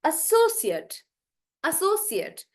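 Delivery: "associate" as "a-SO-sut", each one set apart; stress on SO. Shown as a demonstration. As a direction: stretch SO, shrink the end to "sut". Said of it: "because I'm stressing on so".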